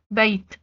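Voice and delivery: "بيت" with the closing t sounded; final ت as T. Shown as a demonstration. Teacher recapping the open taa, final t sounded in "بيت". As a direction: stop on the t of "بيت", no vowel after it. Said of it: "اتنطق التاء زي ما هي".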